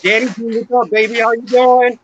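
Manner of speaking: cheerful shout of welcome; excited greeting. Real, not acted. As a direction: greet a friend joining, lit up. Greeting friend joining the stream.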